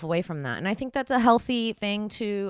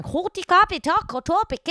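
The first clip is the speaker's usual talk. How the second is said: mock giggly rival; 13 semitones higher, 8 dB louder than usual. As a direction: mimic the rival sim's airhead chatter. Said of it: "Sudden she's going".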